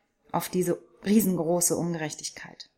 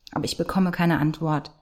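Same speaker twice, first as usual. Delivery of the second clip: ordinary delivery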